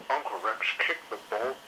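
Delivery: plain reading voice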